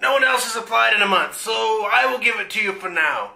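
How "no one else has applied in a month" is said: ordinary delivery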